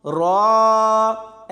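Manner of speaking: रा as one long held vowel; wrong merged sound. Shown as a demonstration. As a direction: draw रा out with no glottal break. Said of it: "ऐसे नहीं पढ़ना".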